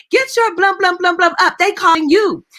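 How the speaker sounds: high, loud, exasperated telling-off; cussing scold. Retold or imitated. Neighbor scolds the child in the waiting room, loud and high. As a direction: snap at the kid to get up, loud and high. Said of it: "she cussed me".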